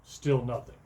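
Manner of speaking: plain reading voice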